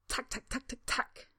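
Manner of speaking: high, clicky beetle voice; beetle clicking. Acted. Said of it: "Stanley offers".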